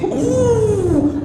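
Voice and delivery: high, drawn-out motor whine; comic very high "uuuh" of a passing motorbike; imitated engine noise. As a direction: mimic a whining exhaust. Imitates motorbike roaring past mosque.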